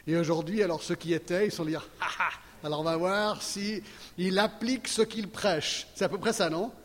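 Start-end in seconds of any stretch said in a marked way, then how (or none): 2.0-5.9 slower, teasing voice of doubting listeners; imitating the skeptics who want to catch him out; mock skeptical aside. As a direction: play the doubters, drawn out and sly. Mocking listeners' imagined skepticism.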